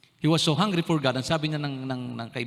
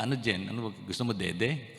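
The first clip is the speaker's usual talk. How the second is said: tender father; 3 semitones lower, 4 dB quieter than usual. Retold or imitated. Father asks baby daughter softly.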